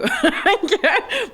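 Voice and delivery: a high, loud laugh bursting out; burst of laughter. Real, not acted. Éclat de rire pendant l'entrevue. (éclat de rire aigu et fort)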